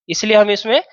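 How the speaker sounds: ordinary talk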